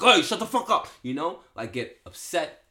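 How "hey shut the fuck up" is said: high, loud snap of an angry parent; angry parent yell. Retold or imitated. Father yelling at his crying kid, loud and angry. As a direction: bark it like a fed-up father losing it. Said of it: "not get emotional with them and be like".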